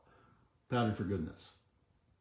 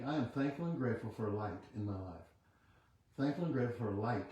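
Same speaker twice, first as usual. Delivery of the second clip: quiet affirmation; 7 dB quieter than usual. Acted. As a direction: murmur the affirmation like a meditation. Leads a chanted affirmation.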